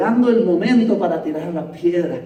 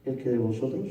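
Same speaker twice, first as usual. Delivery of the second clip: calm authority; 6 semitones lower, 11 dB quieter than usual. Retold or imitated. Jesus speaking to the accusers, quiet and calm. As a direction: let it fall gently but firmly.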